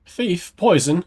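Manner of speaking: usual speaking voice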